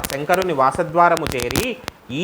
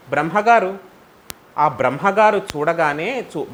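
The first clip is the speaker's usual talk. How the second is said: usual speaking voice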